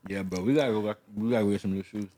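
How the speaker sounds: talking through a mouthful of food; chewing cookie while talking; mouth full. Real, not acted. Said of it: "Talking with food in my mouth".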